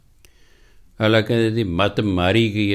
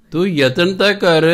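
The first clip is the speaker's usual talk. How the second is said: raised high and loud, pushing the point; urging exhortation; 7 semitones higher, 5 dB louder than usual. Real, not acted. Preacher urging the congregation to try, loud call. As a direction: call out the challenge with a fall on ਤਾਂ.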